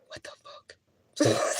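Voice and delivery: slow breathy whisper, dropped low; drawn-out whispered what the fuck. Shown as a demonstration. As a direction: hush it out as silent energy sent upward. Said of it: "sending the energy of".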